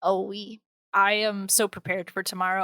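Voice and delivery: usual speaking voice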